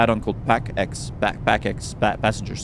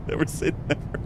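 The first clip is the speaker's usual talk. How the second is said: laughing; 3 semitones higher, 6 dB quieter than usual. Real, not acted. Teasing chat about donations, laughing.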